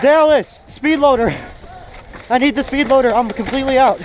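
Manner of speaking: urgent shout to a teammate; calling out. Real, not acted. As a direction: call across the field for ammo. Calling teammate for ammo mid-game.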